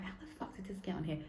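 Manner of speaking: fast incredulous whisper; whispered disbelief. Retold or imitated. Viewer baffled by a TV promo, whispering. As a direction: hiss it under your breath in disbelief. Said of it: "you're just like".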